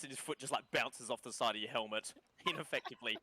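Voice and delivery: normal talking voice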